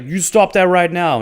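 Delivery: high, loud mock command; aggressive order. [name] storms in to order Titania around, aggressively. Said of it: "you just went in there aggressively".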